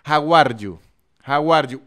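"How are you" with a firm tapped R in "are"; Spanish-R English. Shown as a demonstration. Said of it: "hablar inglés con la R en español".

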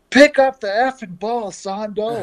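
high, loud mocking yell; retold heckling shout from first base; teammate yelling across field. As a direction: bellow it across the outfield at a teammate. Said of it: "Smoak from right field as a first baseman going".

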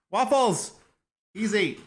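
calling the dog sharply. Real, not acted. Scolding dog biting Maggie.

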